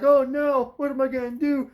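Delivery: high, loud, whiny panic; mock dim son. Eldest son stuck on a sharp object, in whiny mock panic. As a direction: whimper it out like a helpless boy. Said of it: "He's just like".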